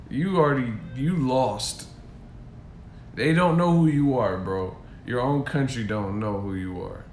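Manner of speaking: normal talking voice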